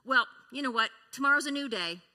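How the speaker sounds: loud breezy brush-off; a spouse dismissing a partner's hard day; dismissive spouse. Retold or imitated. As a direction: wave off their bad day. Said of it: "a spouse mentions that they had a hard day".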